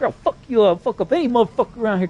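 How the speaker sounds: crazy gangster act. Retold or imitated. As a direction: retell a worked-up gangster's threat. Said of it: "being a gangster, crazy motherfucker".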